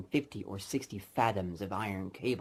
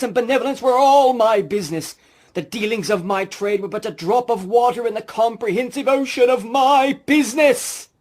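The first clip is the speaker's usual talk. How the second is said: much higher, far louder anguished wailing ghost; ghost lament; 14 semitones higher, 16 dB louder than usual. Acted. Marley's ghost lamenting to Scrooge, loud anguished wail. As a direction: wail it out in despair.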